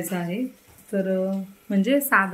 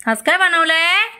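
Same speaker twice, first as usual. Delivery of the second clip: loud question; 9 semitones higher, 7 dB louder than usual. Real, not acted. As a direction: ask him brightly and loudly. Mother quizzing child. (मुलाला मोठ्याने, उंच आवाजात प्रश्न)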